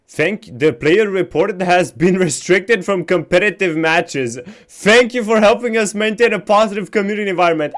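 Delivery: loud, high, gleeful reading through laughter; fast, triumphant laughing read; laughing while reading. Real, not acted. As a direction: read the ban notice, cracking up. Reading ban notice, laughing.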